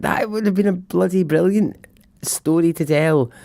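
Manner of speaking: high, exasperated, frustrated outburst; exasperated rant. Retold or imitated. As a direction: vent like the story was stolen. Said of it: "I was livid. I was so annoyed".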